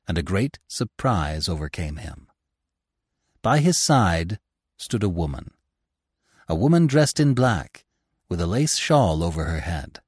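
ordinary delivery, nothing marked

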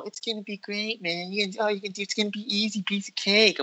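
high, soft, sing-song parody of his reassurance; mocking the director. Mocking the late-arriving director's promises, high sing-song parody. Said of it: "I'm giving him, like, that whole".

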